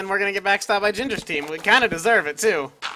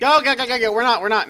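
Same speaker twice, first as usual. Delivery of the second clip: urgent fleeing; 5 semitones higher, 5 dB louder than usual. Real, not acted. Fleeing enemy team in game.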